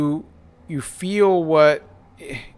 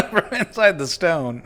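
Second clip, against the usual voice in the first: higher-pitched, talking through laughter; laughing; 5 semitones higher, 3 dB louder than usual. Real, not acted. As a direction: try to get the line out while cracking up.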